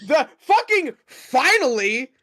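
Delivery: high, loud cheer of relief; drawn-out triumphant shout; excited. Real, not acted. Celebrating a good roll.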